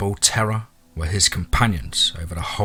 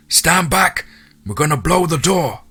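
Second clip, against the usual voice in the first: high-pitched, raised-voice command; shouted warning; 8 semitones higher, 3 dB louder than usual. A narrator's reading. Soldier warns those inside before blasting the door, shouted. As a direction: call a warning through the door. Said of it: "The other male voice responded".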